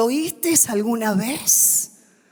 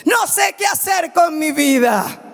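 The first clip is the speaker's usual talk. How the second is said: full-force pulpit preaching; fiery preaching; 7 semitones higher than usual. Acted. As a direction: preach it to the whole church.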